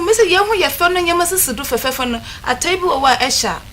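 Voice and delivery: normal talking voice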